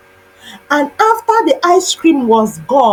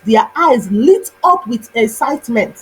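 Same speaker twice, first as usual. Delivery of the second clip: ordinary delivery, nothing marked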